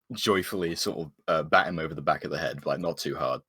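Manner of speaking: ordinary delivery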